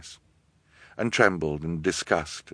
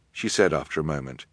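ordinary delivery, nothing marked

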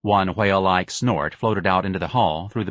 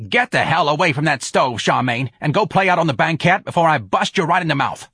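put-on loud, angry yell at a child; screaming; 8 semitones higher, 4 dB louder than usual. A narrator's reading. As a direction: bellow at the kid. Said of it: "screamed at someone".

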